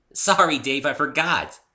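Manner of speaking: high, loud, bubbly pony voice; a cheerful pony brushing off a slip; giggly apology. A narrator's reading. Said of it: "she said with a giggle".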